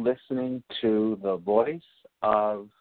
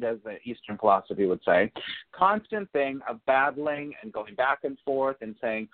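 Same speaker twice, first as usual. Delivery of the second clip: ordinary talk, nothing marked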